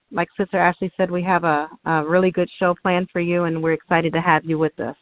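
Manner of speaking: ordinary talk, nothing marked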